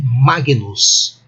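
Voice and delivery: Magnus with hard g and separate n; "Magnus" in reconstructed Latin, g fully sounded; classical Latin pronunciation. Shown as a demonstration. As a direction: say "Magnus" as MAH-gee-noos. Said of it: "eu devo ler assim".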